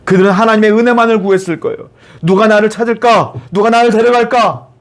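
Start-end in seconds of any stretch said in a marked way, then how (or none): 2.2-4.7 high-pitched, urgent wondering; acted anxious longing; anxious yearning. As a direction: plead inwardly to be picked. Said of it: "마음이 얼마나 조급했을까요?".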